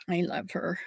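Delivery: a soft fond whisper; breathy little confession of love; whispered aside. Real, not acted. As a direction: whisper it like a secret. Affectionate aside about her friend.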